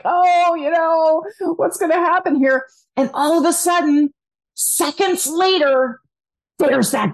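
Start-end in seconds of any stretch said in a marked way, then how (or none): 0.0-2.7 higher, unsure mock-nervous voice; nervous contestant. Contestant waiting for the judges, mildly nervous. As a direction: play the confused contestant on stage. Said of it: "They're a little nervous".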